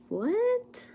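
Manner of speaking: sudden high, breathy puzzled what; a startled, confused question. Real, not acted. Streamer reading a baffling chat message, puzzled.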